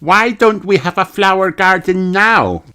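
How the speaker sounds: put-on high child question; asking. A narrator's reading. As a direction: ask like a small girl. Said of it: "Peppa asked".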